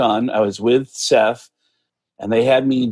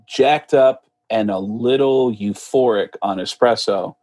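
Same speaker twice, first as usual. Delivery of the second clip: normal talking voice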